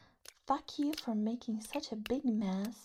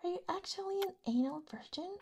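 much higher, fast; teasing surprise; 9 semitones higher, faster than usual. Acted. Mocking the partner, high and quick. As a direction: tease with mock surprise.

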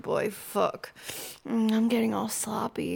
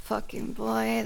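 ordinary talk

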